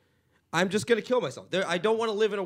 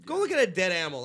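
ordinary delivery

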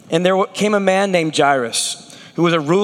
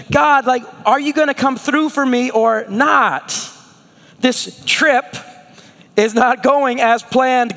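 higher, pleading complaint to God; frustrated prayer; 6 semitones higher than usual. Retold or imitated. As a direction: whine at God like a tired traveler. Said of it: "We're like".

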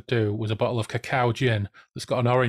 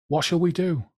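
high, pleading chorus line; acted wail, pitched well up; echoed crowd cry; 6 semitones higher than usual. A narrator's reading. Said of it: "echoed by the crowd".

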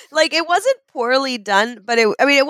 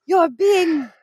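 hammed-up director working up the actor; demanding director; 5 semitones higher than usual. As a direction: start insistent, climb to a theatrical cry. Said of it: "like, a director being like".

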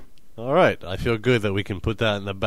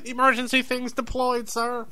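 crew member reporting; 13 semitones higher than usual. Pretend.

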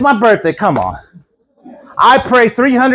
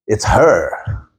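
low, dreading mutter; lower, resigned groan of a line; 10 semitones lower than usual. Retold or imitated. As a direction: sink at the sight of her name. Dreading the caller.

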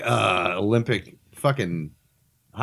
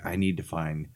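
low, soft lowered mumble; lowered Steve Jobs voice; 7 semitones lower, 9 dB quieter than usual. Retold or imitated. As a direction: grumble it low and quiet. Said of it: "He's like".

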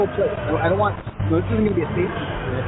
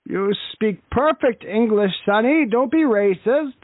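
put-on higher-pitched elderly grandpa voice; old man voice; 5 semitones higher than usual. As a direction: play a proud old war veteran. Prank caller plays old veteran.

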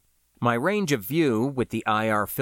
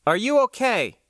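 higher, louder, sharp question; 12 semitones higher, 4 dB louder than usual. Acted. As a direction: snap the question through the helmet. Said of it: "spoke sharply".

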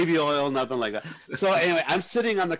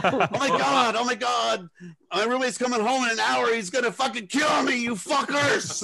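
high, frantic and rising to a shout; hammed-up panic that ends yelling; panicking guy; 9 semitones higher than usual. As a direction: panic about the roommate coming home. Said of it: "some guy in the background go".

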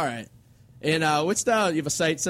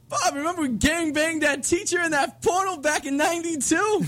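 prank caller voice; 9 semitones higher, slower than usual. Retold or imitated. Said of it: "I go".

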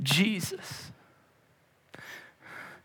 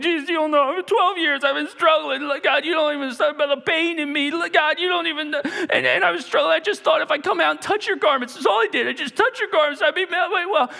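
high-pitched, frantic pleading; fear and trembling; 11 semitones higher than usual. Acted. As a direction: pour out a desperate, tearful confession. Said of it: "in fear and trembling".

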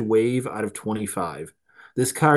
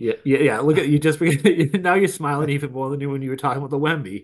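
higher, quick ribbing with a laugh; laughing, fast-talking jab; amused teasing; 5 semitones higher, 4 dB louder, faster than usual. Real, not acted. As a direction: tease a friend, laughing as you go. Ribbing host for smiling at news.

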